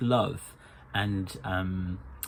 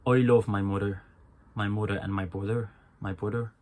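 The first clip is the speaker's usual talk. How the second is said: "mother" and "brother" with a rounded "o", Irish style; Irish accent; faster than usual. Shown as a demonstration. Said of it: "That sounds weird".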